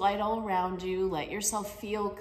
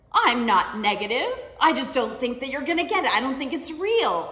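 louder, somewhat higher, firm denial; defensive protest; 4 semitones higher, 6 dB louder than usual. Retold or imitated. Mom insisting to her daughter, louder defensive denial. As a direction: push back hard, defending yourself.

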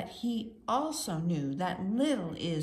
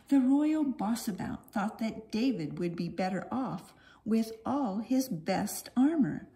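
ordinary delivery, nothing marked

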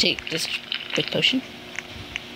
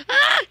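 startled scream; 4 dB louder than usual. Real, not acted.

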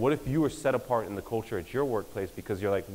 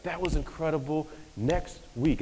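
lightly voiced pastor wrapping up and teasing next week; a hint of a preacher's promo pitch; mock pastor voice; 5 semitones higher than usual. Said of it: "it's like".

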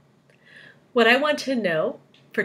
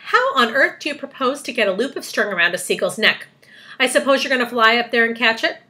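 raised, louder incredulous questioning; scornful challenge; 4 semitones higher, 6 dB louder, faster than usual. A narrator's reading. Earthworm doubting the seagull plan, scornful. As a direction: scoff at an absurd plan.

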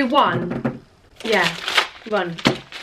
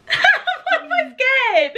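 high, slow giggling; nervous laughing; 10 semitones higher, much slower than usual. Real, not acted. Nervous giggling before counting down.

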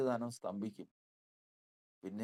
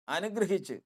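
higher, rising exclamation; exultant; 6 semitones higher than usual. Acted. Preacher joyfully announces the blessing to the church. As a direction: burst out with the good news.

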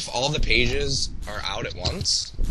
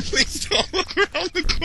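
much higher, laughing mock plea; squeaky mock plea; 3 dB louder than usual. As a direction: squeak it out like a scared kid. Mocking repeat with laugh.

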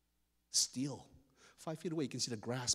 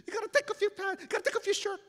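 high, loud wail; frantic blubbering kid; crying; 5 dB louder, faster than usual. Acted. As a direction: urge it through acted tears. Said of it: "Keoki is crying".

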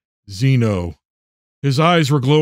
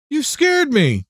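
put-on high-pitched girl's complaint; startled daughter; 12 semitones higher, slower than usual. A narrator's reading. Daughter woken by her father, high startled complaint.

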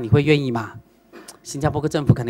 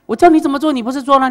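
insistent master; 8 semitones higher, 9 dB louder, faster than usual. Retold or imitated.

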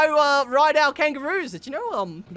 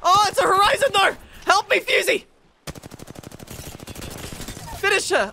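higher, louder urgent calls; excited gamer shouts; 9 semitones higher, 5 dB louder than usual. Real, not acted. Gamer urging teammate mid-fight, excited shouting. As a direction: cheer your teammate on urgently.

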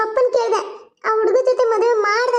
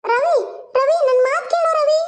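much higher, slow desperate plea; pleading; 7 semitones higher, slower than usual. Acted. Mother begging her angry son Ravi to listen, pleading. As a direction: beg him to hear you out.

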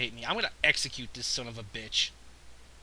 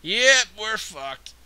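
resigned; 6 semitones higher, 9 dB louder, much slower than usual. Real, not acted. Battle lost, resigned.

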